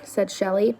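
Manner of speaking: plain reading voice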